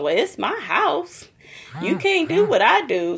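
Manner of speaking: high-pitched mock retort; Mariah. Vlogger mimicking hostess defending her blue outfit, high mock retort. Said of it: "And Mariah talking about".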